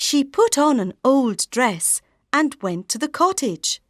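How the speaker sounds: usual speaking voice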